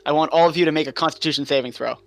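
normal talking voice

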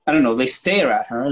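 ordinary delivery

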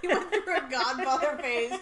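loud laughing disbelief. Real, not acted.

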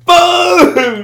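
loud, high, slow boom; excited boom. Retold or imitated. Telling a mate about the jump, a loud high boom. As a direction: stretch the boom out big and loud.

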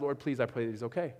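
low, soft plea; retold quick prayer. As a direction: drop the voice as if praying privately. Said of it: "my immediate thought was".

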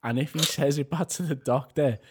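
ordinary delivery

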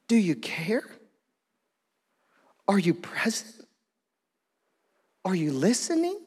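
doubting prayer. Acted. Voicing doubting prayer to God.

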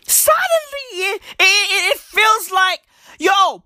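high, straining panic about apartheid returning; panicked. Acted. Comedian wailing to the crowd that apartheid is back. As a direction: voice cracking with mock alarm.

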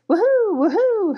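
high, loud owl hooting; bright woohoo owl call. Acted. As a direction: hoot it out like an owl. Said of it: "hooted Mama Owl".

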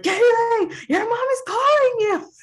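very high, loud mock yell; imitating Isaiah yelling at the daughter, very high and loud; Isaiah shouting. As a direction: bellow at the kid in a squeaky put-on voice. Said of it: "Isaiah's like".

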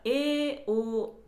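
えお sung out clearly, loud; teacher voicing えお; vowel demo. Shown as a demonstration. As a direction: isolate the vowels えお. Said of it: "母音が同じじゃないですか".

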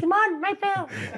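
hammed-up tiny doll voice, amused; imitating a doll. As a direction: squeak it out like a little toy doll. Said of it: "a las muñequitas así".

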